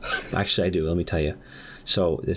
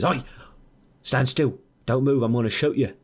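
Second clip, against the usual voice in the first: higher, mock-threatening Aussie voice; Australian accent; 5 semitones higher than usual. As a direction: challenge someone as a cartoonish Australian. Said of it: "he's clearly Australian".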